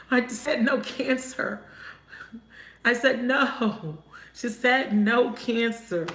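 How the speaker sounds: slow, emphatic "no" on each line; firm no. Real, not acted. Reader scolds Spirit for sending Cancer, slow and firm. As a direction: put your foot down on "no Cancer".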